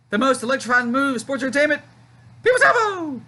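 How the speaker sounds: Jerry Lawler impression. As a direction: sell the move like a TV play-by-play man climbing to a peak. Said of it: "That's my Jerry Lawler impression".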